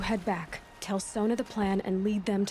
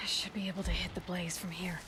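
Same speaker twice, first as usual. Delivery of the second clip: soft whisper while hiding; 6 dB quieter than usual. Acted. Sneaking hunter whispering her aim to herself. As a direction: keep it hushed so the enemies miss you. Aloy sneaking near the enemy base.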